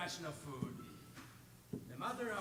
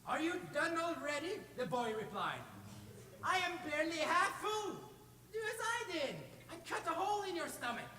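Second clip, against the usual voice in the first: high, cheeky taunting voice; mock surprise; 8 semitones higher than usual. Boy tricking the troll into cutting his stomach, high and sly. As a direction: goad him on, sly and playful.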